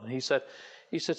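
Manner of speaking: ordinary talk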